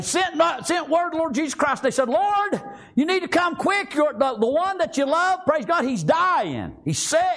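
very high-pitched pleading cry; urgent preaching. Acted. Sisters send word to Jesus that Lazarus is dying, urgent. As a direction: preach the message as an urgent plea.

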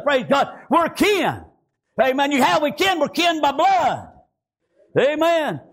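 normal talking voice

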